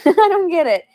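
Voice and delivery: baffled laughing outburst. Real, not acted. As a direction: laugh through the words. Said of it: "laughing confusion".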